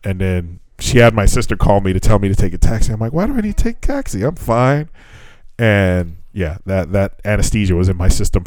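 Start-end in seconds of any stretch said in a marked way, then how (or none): 3.1-4.9 high, fast, softer retold protest. Groggy patient tells sister no taxi, protesting. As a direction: push back in a high quick voice.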